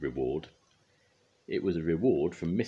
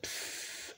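put-on breathy voiceless psst from the baby; a tiny unvoiced hiss of a word; baby's psst; 13 dB quieter than usual. A narrator's reading. The baby says it to Mog: voiceless psst. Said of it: "It said".

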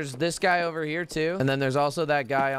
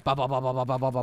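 rapid lower "ba" burst; imitated gunfire; 3 semitones lower, much faster than usual. Streamer showing teammates how the fight went, mouthed gunfire. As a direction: mouth out machine-gun fire.

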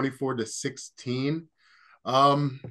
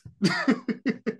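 short high giggle; a quick self-mocking chuckle; amused laugh; 11 semitones higher, 4 dB louder than usual. Real, not acted. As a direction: laugh at yourself. Laughing at own bad accent.

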